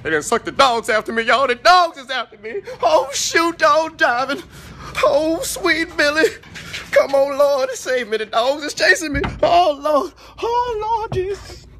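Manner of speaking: high, loud wailing for rescue; hammed-up panic. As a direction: cry out to the Lord as if chased by dogs. Acts fleeing from chasing dogs.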